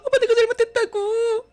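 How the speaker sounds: very high, loud wailing plea; sister-in-law clinging to his leg, wailing high and loud; begging tearfully. Acted. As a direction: beg on the floor, sobbing. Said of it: "다리 잡고 빌더라고요".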